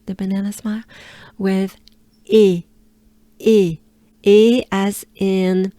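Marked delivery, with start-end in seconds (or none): 2.2-4.6 "E" as French é like the end of café, punched louder; contrasting French é. Shown as a demonstration. Teacher separating two vowels for learners, loud clear é. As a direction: hit "E" loud as a French é, not ee. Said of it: "to differentiate this sound".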